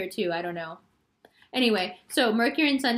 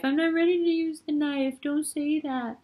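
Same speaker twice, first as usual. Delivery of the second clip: 1 panicky Libra; 6 semitones higher than usual. Pretend. Libra voiced popping out of deck.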